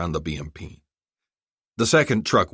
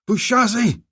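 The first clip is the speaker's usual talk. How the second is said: much higher, louder call; shouting; 6 dB louder than usual. Acted. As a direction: shout his name at the truck. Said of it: "he shouted".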